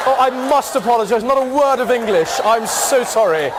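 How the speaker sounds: loud, exaggeratedly eloquent English; over-proper English apology. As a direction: apologize grandly in flawless, emphatic English. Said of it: "the equivalent of meeting".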